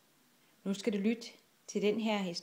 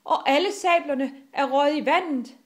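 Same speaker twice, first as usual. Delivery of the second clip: loud, high cry of dismay; shout; 6 semitones higher, 6 dB louder than usual. A narrator's reading. Bølle Bent sees sabres lost, shouting. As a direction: yell it over the storm. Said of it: "råbte Bølle Bent".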